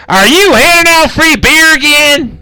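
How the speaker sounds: a shrill-pitched tavern boss calling out; high-pitched barkeep voice. Acted. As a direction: tavern boss yells from the back. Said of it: "you hear".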